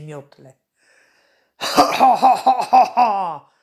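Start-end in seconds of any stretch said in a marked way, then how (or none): 1.6-3.5 loud, high staccato cackle; evil witch cackle. A narrator's reading. Witch swooping over the gnomes on her broom, loud wicked cackle. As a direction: cackle in triumph like a storybook witch.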